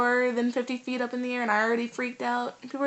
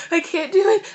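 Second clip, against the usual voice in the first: retold panicked cry; 8 semitones higher, 7 dB louder than usual. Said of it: "I started crying".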